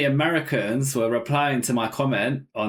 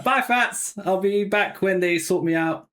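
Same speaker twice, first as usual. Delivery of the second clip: high, sing out a carefree goodbye; breezy mock farewell; 6 semitones higher than usual. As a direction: pretend to wave a friend off, pitched up. Imagined cheeky farewell to friend.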